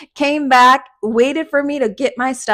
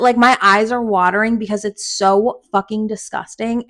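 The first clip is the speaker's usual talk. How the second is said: ordinary delivery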